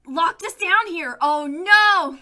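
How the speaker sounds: loud dismayed cry; shout. Acted. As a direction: yell in alarm. Players locked in basement.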